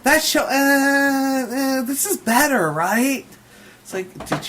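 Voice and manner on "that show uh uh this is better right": high, slow, sing-song mock of the singer. Imitating the singer teasing her audience, sing-song. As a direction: ham up her smug stage banter. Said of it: "she goes".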